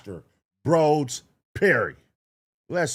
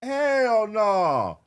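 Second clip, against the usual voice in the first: high, slow, stretched-out disbelief; drawn-out high shock; 8 semitones higher, much slower than usual. Retold or imitated. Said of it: "I was like".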